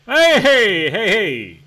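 loud, high holler that drops; high, loud heys sliding down; cheering call. Real, not acted. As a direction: answer the whoop with a big holler.

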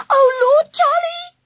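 high, loud startled cry of fright; frightened gasp. A narrator's reading. Angela sees the bulls and gasps in fear. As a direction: gasp it out in sudden terror. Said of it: "she gasped".

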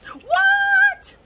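very high, loud astonished squeal; squealed what. Real, not acted. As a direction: squeal in disbelief. Reacting to colour.

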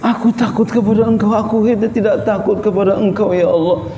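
high, pleading cry to God; imitated weeping prayer. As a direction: plead like someone crying in prayer. (menirukan doa sambil menangis, nada tinggi)

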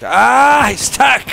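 high, loud excited cry; excited shout. Real, not acted. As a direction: yell it out in delight. Grenade stuck to enemy.

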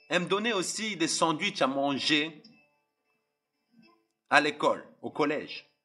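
ordinary delivery

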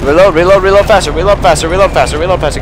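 urgent, fast repeated urging; frantic urging. Real, not acted. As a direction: hurry them up, frantic. Urging teammates mid-fight.

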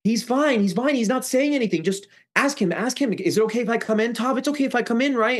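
very high, whiny mock pleading; high, put-on wheedling voice; imitated pleading Annie. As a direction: imitate someone begging to be let in. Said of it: "So Annie goes".

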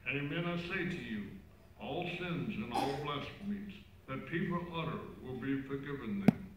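cathedral echo. Real, not acted. Gospel read far off.

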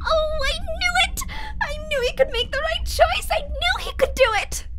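thrilled, near tears; giddy triumph. Acted. Overjoyed at his choice.

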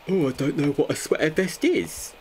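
high, mocking parroting voice; mocking imitation. Streamer mocking someone's sweater vest question, high mimicry.

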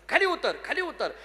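high, swooping insistent call; repeated impatient-sounding summons. Acted. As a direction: keep summoning him down. Devotee repeats his call to God.

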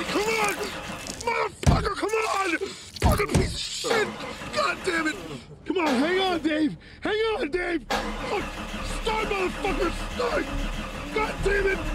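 frantic cursing. Acted. Desperate, car won't start.

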